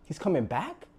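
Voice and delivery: high rising "What?" in disbelief; surprised father. Retold or imitated. Father hearing his son is returning, rising in surprise. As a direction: catch the news in a rising gasp of surprise. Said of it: "And the father's like".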